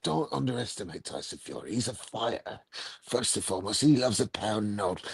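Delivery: a hint of the boxing promoter, dropped low and quiet; Eddie Hearn impression. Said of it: "Is that a better Eddie impression".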